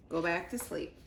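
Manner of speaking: ordinary delivery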